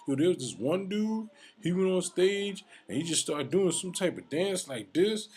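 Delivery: high, loud mocking impression. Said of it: "who made fun of my performance".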